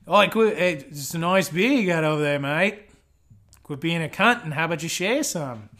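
punchy Aussie banter with swooping inflections; mock Australian accent. Aussie demanding a mate share the beer, mock Australian accent. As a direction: ham up a rough-and-ready Australian accent. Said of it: "if that's a good Australian".

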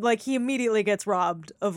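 ordinary delivery

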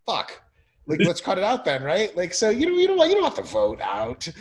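loud, high exasperated outburst; hammed-up panicked strategist yelling to shut it down; mock frustrated shout. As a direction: blurt it like a campaign boss seeing bad numbers. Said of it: "They'd be like".

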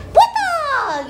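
high, falling kung-fu cry on "Water!"; teriakan film kungfu. Acted. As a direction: yelp "Water!" like a martial-arts movie fighter. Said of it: "Kayak film kungfu". (teriakan kungfu bernada tinggi)

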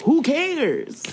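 high, swooping dismissal; dismissive. Real, not acted. Cohost brushing off an edited video, dismissive. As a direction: throw it out high, then let it fall.

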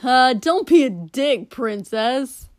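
loud heckling yell from the side; sudden shout. Acted. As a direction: yell it over the crowd. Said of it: "Katsuki shouted suddenly".

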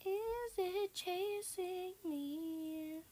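high, very slow, stretched-out question; nervous drawn-out question. Real, not acted. Player fleeing a monster in a game, nervous drawn-out question. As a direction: draw every word out high, uneasily.

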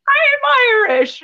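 high, loud mock Irish protest; bad Irish accent. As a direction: imitate a hopelessly muddled Irish accent, loudly. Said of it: "it's always coming out as, like, an Australian".

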